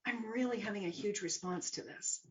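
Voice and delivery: soft, measured talk to yourself; hushed, self-observing realisation; calm acknowledgement. Retold or imitated. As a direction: step back and quietly admit it. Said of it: "come up here and acknowledge".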